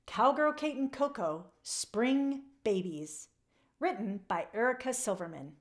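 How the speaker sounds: plain reading voice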